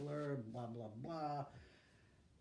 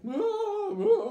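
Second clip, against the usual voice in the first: a burst of high, loud laughter; 16 dB louder than usual. Real, not acted. Laughing at the dressed-up crowd.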